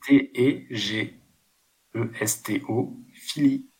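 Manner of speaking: slow letter-by-letter spelling of tégestophilie, each letter of T E G E S T O said apart. Shown as a demonstration. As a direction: spell T E G E S T O slowly, then say philie. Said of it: "ça s'écrit avec un T".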